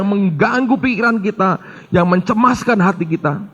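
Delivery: rising, forceful sermon warning; fervent preaching. Acted. (khotbah berapi-api yang memperingatkan jemaat)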